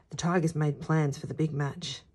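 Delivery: plain reading voice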